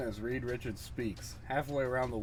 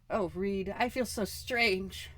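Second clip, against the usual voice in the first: put-on higher, alarmed young woman's voice; frightened Susan; 11 semitones higher, slower than usual. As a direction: play Susan panicking as she fades away.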